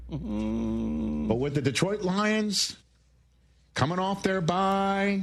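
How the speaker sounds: high, very slow voice; high register voice. Acted. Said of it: "high register take".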